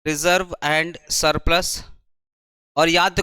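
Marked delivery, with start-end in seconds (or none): 0.0-1.8 drawn out slowly for writing down; slow dictation. Shown as a demonstration. Teacher giving the answer for students to write, slowly. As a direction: stretch each word for note-taking. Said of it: "P से क्या याद आएगा?".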